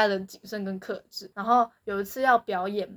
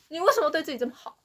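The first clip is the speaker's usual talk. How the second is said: quoted line; 6 semitones higher, faster than usual. Retold or imitated. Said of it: "这就是说".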